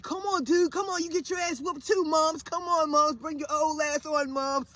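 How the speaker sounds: high-pitched mocking heckle; taunting boys. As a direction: imitate cocky kids daring a mom. Mimics boys taunting her.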